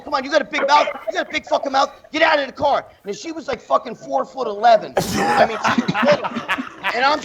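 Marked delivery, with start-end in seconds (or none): 0.0-2.8 higher-pitched mock confrontation; acted taunting challenge. Woman goading him out of the car, imitated taunt. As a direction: imitate someone spoiling for a fight. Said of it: "And she's going".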